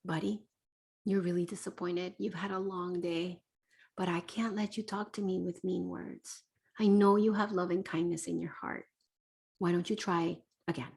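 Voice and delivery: soft, gentle parent voice; calm reassuring. Shown as a demonstration. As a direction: say it quietly and kindly. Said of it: "you're gonna have to say that".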